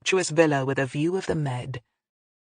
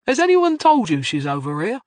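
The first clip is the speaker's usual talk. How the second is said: loud, higher jovial Cockney question; 6 semitones higher, 6 dB louder than usual. A narrator's reading. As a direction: ask with hearty Cockney cheer. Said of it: "Liam Hardwick asked jovially".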